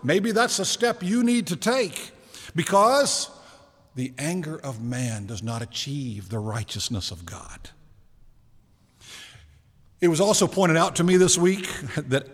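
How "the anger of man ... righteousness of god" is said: whispered scripture line. Real, not acted. Pastor whispers the key verse to his congregation. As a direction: whisper the verse to let it land.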